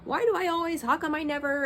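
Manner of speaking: high, pleading self-questioning; anguished inner voice. Retold or imitated. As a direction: ask yourself desperately why. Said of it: "I've been thinking".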